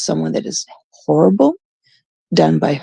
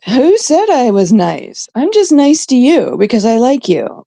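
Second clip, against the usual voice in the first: high, playful mock challenge; joking sass; 4 semitones higher than usual. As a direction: deliver a cheeky joke, pitched up. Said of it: "I always make the joke".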